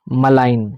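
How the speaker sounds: मलाइन with a short, quick म and the stress on लाइन; correct pronunciation. Shown as a demonstration. Teacher modelling मलाइन for students, म short and लाइन stressed. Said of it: "मेलाइन मैं नहीं बोल रहा हूँ".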